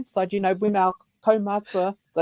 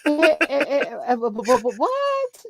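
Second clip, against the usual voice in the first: high, laughing stammer of shock; stunned stammer; 8 semitones higher than usual. Retold or imitated. Facing famous elders on the pae, stammering in shock. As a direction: stutter it out like you can't believe who is in front of you. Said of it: "And I was like going".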